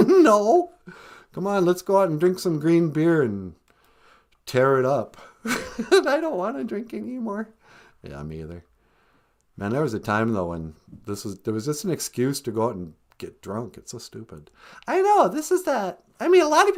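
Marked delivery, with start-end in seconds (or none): 1.3-5.2 much lower put-on grandma voice; low, playful old-lady voice answering from upstairs; Nana character voice. Nana voice continues. 8.0-14.5 much lower put-on grandma voice; low, playful old-lady voice answering from upstairs; Nana character voice. Nana voice continues.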